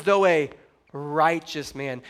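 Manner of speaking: "righteous" set apart after a pause, drawn out slowly; pause, then stretch "righteous"; slow, weighty emphasis on "righteous"; stress on righteous. Shown as a demonstration. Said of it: "It says".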